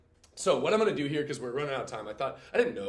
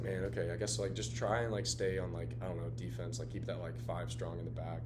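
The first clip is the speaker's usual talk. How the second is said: dejected coach; 6 semitones lower, 5 dB quieter than usual. Retold or imitated. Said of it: "dejected attitude".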